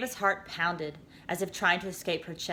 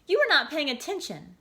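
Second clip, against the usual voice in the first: higher sighing reproach; 9 semitones higher, 4 dB louder than usual. A narrator's reading. Voice scolding the girl, sighing and higher. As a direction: sigh it out, disappointed. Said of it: "the voice said with a sigh".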